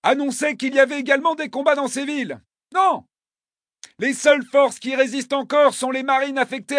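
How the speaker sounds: high, pressed report shouted over noise; urgent shouted report; urgent soldier voice. Acted. Radio report of fighting.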